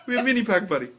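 high mock-Israeli accent, amused; Israeli salesman. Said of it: "he is not native to this country".

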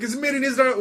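high mock-Israeli accent; Israeli salesman. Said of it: "there was some Israeli dude".

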